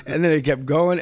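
ordinary talk, nothing marked

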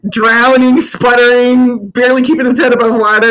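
loud, high, dramatic lament; hammed-up exclaiming. Teasing co-host over the drowning soul, hammed-up and loud. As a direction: belt it out as mock drama.

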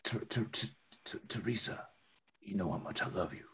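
soft, stammering love confession; tender stammer. Acted. Nervous romantic confession, soft.

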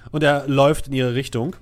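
ordinary talk

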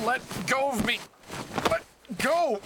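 struggling protest. Acted. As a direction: fight to break free as you yell. Struggling while held down.

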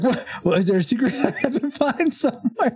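high-pitched mock bewilderment with a laugh; puzzled reaction. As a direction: act out baffled disbelief, voice climbing high. Retells own puzzled reaction, laughing.

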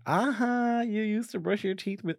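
put-on high-pitched kid catching dad out; gleeful taunt. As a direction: crow it like a teasing child. Retold childish taunt to dad.

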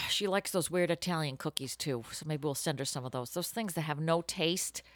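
ordinary delivery

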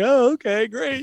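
mock unimpressed reply; mock sarcastic okay. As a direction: play along, not buying it. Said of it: "And I was like".

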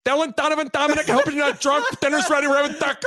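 high, loud mom calling out; mom calling kids. Pretend. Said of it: "the Raiola mom calling him for dinner".

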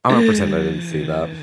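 silly voice. Pretend. Jokey mock quote.

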